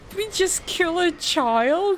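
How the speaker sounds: high, slow shocked question; horrified whisper-high disbelief; appalled. Real, not acted. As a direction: gasp it out in disbelief. Game makes them sacrifice a child.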